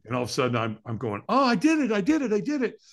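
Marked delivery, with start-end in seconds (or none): 1.3-2.8 high-pitched, repeated victory cry; elated celebration. Retold or imitated. Firewalker celebrates at end of coals, elated. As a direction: celebrate in giddy disbelief.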